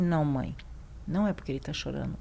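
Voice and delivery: voz grave e suave de médico atencioso; cuidadoso e carinhoso. Retold or imitated. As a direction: explique baixinho à mãe, com delicadeza. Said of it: "com muito cuidado, muito carinho". (tom baixo, carinhoso, imitando o médico)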